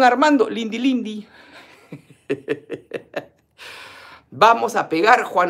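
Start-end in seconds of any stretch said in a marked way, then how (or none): 2.3-3.2 low rippling chuckle; tickled little laugh. Real, not acted. Host tickled by Juan Armando's Lindy Lindy vote, chuckling.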